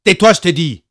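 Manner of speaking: stern father growling; father scolds. A narrator's reading. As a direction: snap it down at the boy. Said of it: "gronda son père".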